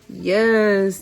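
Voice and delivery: usual speaking voice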